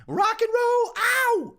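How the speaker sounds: mock rock and roll wail; rock shout. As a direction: belt it like a rock singer. Rock host self-intro shout.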